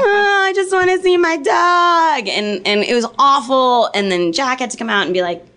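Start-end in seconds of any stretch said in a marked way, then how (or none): 0.0-2.3 slow, much higher, whining plea; mock whiny wail. Imitating the handcuffed woman pleading, slow and whiny. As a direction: whine it like a desperate intruder. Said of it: "And she's like".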